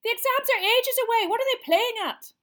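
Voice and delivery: high, loud, fast complaint; loud rant. A narrator's reading. Boy ranting at the common room, loud and fast. Seamus continues.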